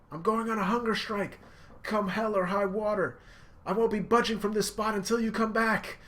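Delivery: high, loud emotional yelling; impassioned shouting. Retold or imitated. Luffy yelling that Sanji is lying. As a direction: call him out, all heart.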